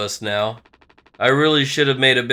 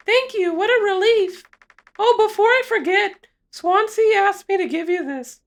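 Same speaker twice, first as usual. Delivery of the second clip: high feminine character voice; high female voice; 3 dB louder than usual. Acted. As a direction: play the evaluator up high. Female evaluator voice.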